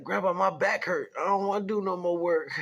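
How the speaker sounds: high, loud mock complaint to grandpa; whining kid.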